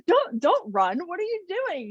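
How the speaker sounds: high-pitched mock alarm; retold self-scolding. Telling herself off for running at the gym, mock scolding.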